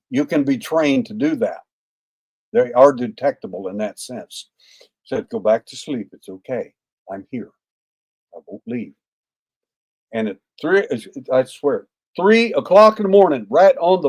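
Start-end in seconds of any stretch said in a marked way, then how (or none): 5.3-8.9 soft, low soothing voice; gentle reassurance. Retold or imitated. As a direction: quietly comfort a frightened sleeper. Soothing a frightened sleeper at night.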